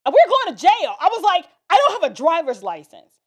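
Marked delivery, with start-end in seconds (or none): 0.1-1.0 loud, high panicked outburst; retold panic. Driver to niece as police lights appear, panicking. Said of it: "I'm freaking out". 1.7-3.2 loud, alarmed confession; retold panic. Unlicensed teen driver to her niece, panicking. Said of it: "I'm freaking out".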